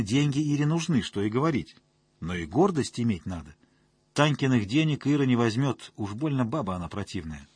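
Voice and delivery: ordinary delivery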